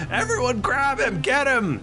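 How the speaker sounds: high, urgent acted command; rally everyone to seize him; panicked guard order. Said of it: "he goes".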